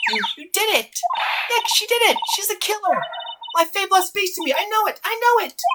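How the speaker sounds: put-on very high, agitated girl's voice; excitable accusing girl. Girl telling Nick she is the killer, frantic accusation. Plays Maya accusing the woman.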